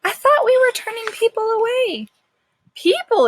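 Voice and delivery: complain, fed up; exasperated worker. Acted. The worker protests to Cowboy, high and exasperated.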